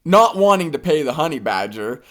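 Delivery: ordinary delivery, nothing marked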